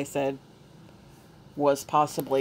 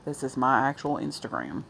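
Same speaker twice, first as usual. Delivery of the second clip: hoarse, strained voice; losing voice; 3 dB louder than usual. Real, not acted. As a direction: voice giving out, hoarse. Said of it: "I'm losing my voice".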